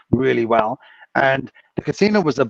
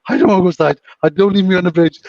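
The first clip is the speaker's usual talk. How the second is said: raised, louder imitation of alarm; mock pleading refusal; 5 semitones higher, 5 dB louder, faster than usual. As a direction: playfully act out a scared man refusing. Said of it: "they were arguing on the floor".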